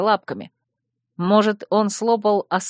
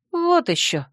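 high, dismissive snort of a retort; scoffing; 10 semitones higher than usual. A narrator's reading. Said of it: "фыркнула я".

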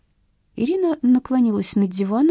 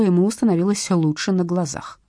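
plain reading voice

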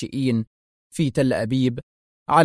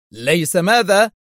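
raised, slow, indignant question; angry shout; 5 semitones higher, 4 dB louder, slower than usual. A narrator's reading. Zelman reacts to the principal's answer, angrily raising his voice. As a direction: stretch it out in moderate anger. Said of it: "صرخ زيلمان في غضب".

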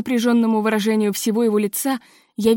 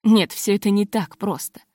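ordinary delivery

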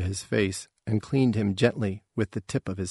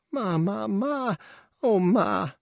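put-on high, wailing child's sob; small child's high-pitched wail; sobbing child; 11 semitones higher, slower than usual. A narrator's reading. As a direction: cry for mother in a very high voice. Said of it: "licking her face and sobbing".

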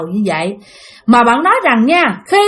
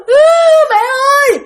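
high-pitched imitated wail; shrieking cry; 4 dB louder, much faster than usual. Revived child crying for mother, shrieking cry. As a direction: burst into a child's sobbing call. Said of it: "bạn mới khóc lên, ré lên".

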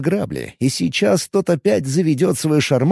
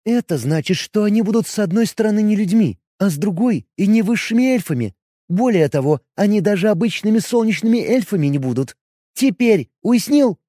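patient higher explaining; as to a child; 7 semitones higher than usual. Acted. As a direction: spell it out like to a child. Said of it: "как для ребёнка".

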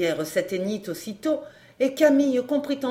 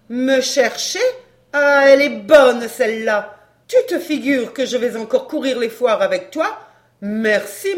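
jeering woman; 3 semitones higher, 6 dB louder than usual. A narrator's reading. Woman refusing to work the fairs with him, jeering. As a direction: scoff at him loudly.

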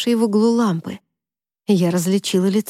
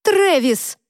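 much higher yell at him; yelling across the room; 9 semitones higher than usual. Acted. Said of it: "закричала я". (крик через всю комнату)

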